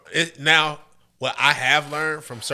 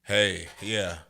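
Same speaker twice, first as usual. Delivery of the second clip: low, smooth hey; deep voice; 5 semitones lower than usual. Shown as a demonstration. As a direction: go deep and easy. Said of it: "they like a little deep".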